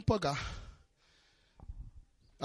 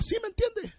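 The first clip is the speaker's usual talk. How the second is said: much higher, softer mock dad voice; imitating dad; 5 dB quieter than usual. Pastor imitating his dad's catchphrase for the church, high and mock. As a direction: play your father asking it. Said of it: "Como diría mi papá".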